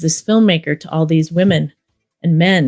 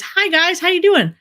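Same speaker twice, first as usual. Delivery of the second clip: much higher-pitched retold hello; re-enacted cheery greeting; 12 semitones higher than usual. Filmmaker introducing herself to everybody, high re-enacted greeting.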